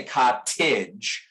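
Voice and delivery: "cottage" slow, with a crisp sharp T; British sharp T. Shown as a demonstration. Teacher imitating a British speaker's crisp T, slow. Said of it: "pronounce that T much more sharply".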